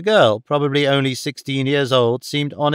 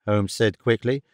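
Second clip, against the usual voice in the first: usual speaking voice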